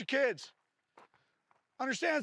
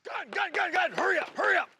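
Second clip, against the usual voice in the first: high, loud hurried calls; urgent shouting; 8 semitones higher, 4 dB louder than usual. Real, not acted. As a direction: push the class to move fast. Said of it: "drill urgency calls".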